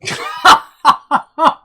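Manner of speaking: big high burst of laughter; loud laugh. Real, not acted. Laugh after whisper line.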